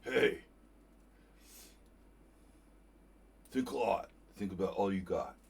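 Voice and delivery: loud, attempted New York accent; Fed impression. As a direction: have a rough go at a punchy New York accent, loudly. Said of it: "I can't do the New York accent".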